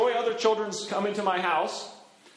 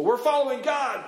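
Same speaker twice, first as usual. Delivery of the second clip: higher-pitched, confident cry; enthusiastic declaration; 7 semitones higher than usual. Acted. Israelites in the wilderness declaring loyalty, enthusiastic. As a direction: declare it eagerly. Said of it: "One minute they're saying".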